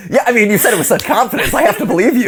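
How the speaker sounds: usual speaking voice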